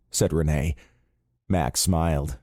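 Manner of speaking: ordinary delivery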